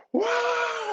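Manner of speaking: high-pitched cheering "whoa"; long, high whoop of a screaming crowd. Retold or imitated. A dance class cheers on the shy girl, imitated screaming. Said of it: "they start screaming".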